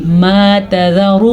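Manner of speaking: "ما تذر" slow, with a brief ru ending; short final vowel. Shown as a demonstration. Teacher showing unlengthened ra, "ما تذر" ending short. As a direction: keep the end of "ما تذر" short. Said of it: "را کو لمبا نہیں کرنا".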